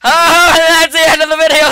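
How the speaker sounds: laughing as the video ends; laughing outro. Real, not acted. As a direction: burst out laughing into the sign-off.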